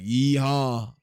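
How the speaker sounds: loud mock-Southern holler; hammed-up Southern whoop; Southern accent. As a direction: put on a Southern cowboy cheer. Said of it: "my best impression of a Southern accent".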